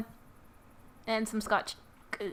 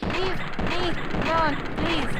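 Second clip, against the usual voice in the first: pleading shout; 4 semitones higher, 8 dB louder, slower than usual. Real, not acted. Swarmed by enemies.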